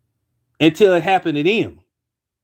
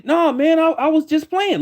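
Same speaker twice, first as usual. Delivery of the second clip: high, pleading coworker voice; voiced coworker; 8 semitones higher than usual. Coworker pleading he was only playing, in a high voice. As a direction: play the sheepish coworker backing down, pitched high.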